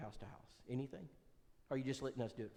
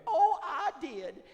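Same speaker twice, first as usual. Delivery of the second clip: high falsetto, slow and drawn out; slow falsetto imitation of Brenda; Brenda's voice; 9 dB louder, much slower than usual. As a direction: gush out thanks in her voice. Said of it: "Brenda answers".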